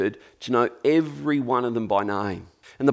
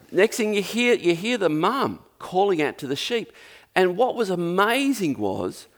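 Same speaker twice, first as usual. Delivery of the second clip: ordinary talk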